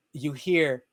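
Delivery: usual speaking voice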